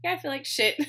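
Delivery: wry blunt confession; blunt admission. Retold or imitated. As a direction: toss it off with rueful frankness. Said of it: "I was like".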